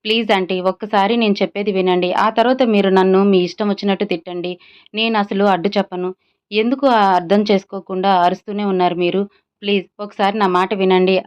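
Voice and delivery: usual speaking voice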